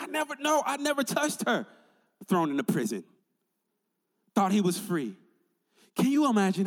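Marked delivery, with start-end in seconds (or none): 0.0-1.7 high-pitched mock protest of innocence; Joseph protesting. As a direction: play an accused man's denial in a high voice. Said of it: "He's like".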